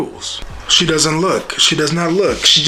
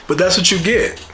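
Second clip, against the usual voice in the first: higher, louder verdict; emphatic verdict; 7 semitones higher, 4 dB louder than usual. Real, not acted. Vlogger declaring Cassie's comeuppance, high and loud. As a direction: land it like a judgement. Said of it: "repeated verdict louder".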